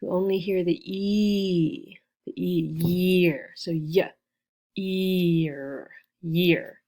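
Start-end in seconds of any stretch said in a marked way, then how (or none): 0.8-2.0 "E" held as one long steady vowel. Shown as a demonstration. Teacher says you only hear the first vowel, long E held. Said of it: "just a long E". 3.7-5.9 YEAR split into "y" and a stretched "ear"; sounded out. Shown as a demonstration. Teacher goes back over year, sounded out. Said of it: "count the sounds again".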